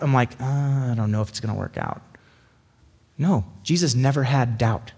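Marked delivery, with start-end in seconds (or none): 0.4-2.0 low, quick, doubtful murmur. Acted. Speaker voicing his own creeping doubt, doubtful. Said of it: "creeping in a little bit of doubt".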